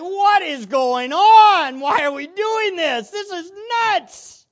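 loud, high, drawn-out mock outcry; acted exasperation. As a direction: wail it out as if at wit's end, hammed-up. Retells his past exasperation.

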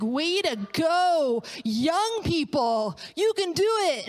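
high, drawn-out cheer; cheering encouragement. Retold or imitated. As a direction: cheer them on like a fan. Cheering on young TV dancers.

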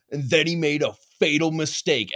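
lightly hammed-up ominous voice-over; imitated documentary narrator. Friend quotes the turkey attack special, imitated narrator. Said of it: "and it was like".

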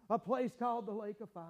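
ordinary talk, nothing marked

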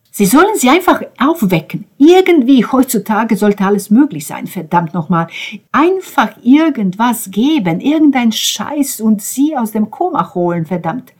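high, loud, frustrated rant; desperate angry demand. A narrator's reading. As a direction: demand it with rising exasperation. Ben demands they wake her.

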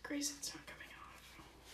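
urgent whisper. Real, not acted. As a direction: whisper it in a panic. Panicked whisper.

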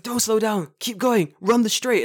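high-pitched coach's urging, no real shout; mock shouting. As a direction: imitate a man yelling orders from a bench. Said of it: "Shouting at me".

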